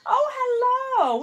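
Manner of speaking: high, slow sing-song hello; delighted greeting. Real, not acted. Greeting a VIP viewer in chat.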